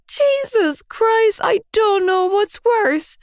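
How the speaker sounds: high, slow anguished wail. A narrator's reading. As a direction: wail it in despair. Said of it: "I wailed".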